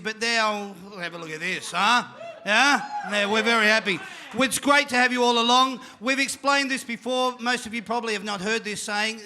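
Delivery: ordinary talk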